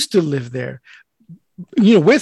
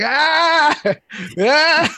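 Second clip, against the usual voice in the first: mock scream; 10 semitones higher than usual. Retelling being over there screaming, mock scream. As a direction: let out a high-pitched pretend scream. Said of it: "I'm over there screaming like".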